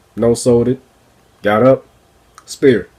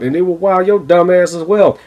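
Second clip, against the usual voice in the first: ordinary talk, nothing marked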